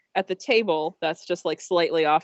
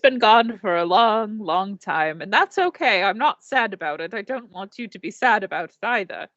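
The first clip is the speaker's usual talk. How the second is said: put-on British accent. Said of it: "all of a sudden British".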